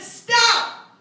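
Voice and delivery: sudden loud high "Stop!"; shout. Retold or imitated. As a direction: cut the class off sharply. Teacher shouting at class.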